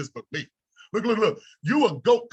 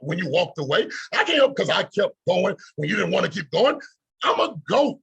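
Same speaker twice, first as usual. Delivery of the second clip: ordinary talk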